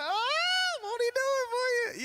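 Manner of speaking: very high, exultant churchy cry; gospel praise. Retold or imitated. Praising himself after his movie line, high exultant cry. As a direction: celebrate like testimony in church.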